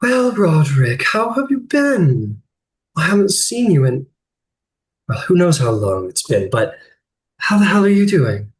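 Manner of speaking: lilting, melodic woman's voice; sing-song voice. Acted. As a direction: sing every line out sweetly. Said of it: "the most beautiful sing-song voice comes from her lips".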